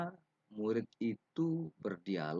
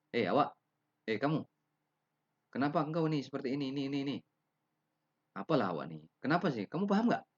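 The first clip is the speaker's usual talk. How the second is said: louder, higher, fast impatient questioning; rude to teacher; 7 semitones higher, 5 dB louder, faster than usual. Shown as a demonstration. Rude student pressing the teacher, fast and higher. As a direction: pester the teacher disrespectfully. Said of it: "perkataan-perkataan seperti ini tidak pantas kepada guru".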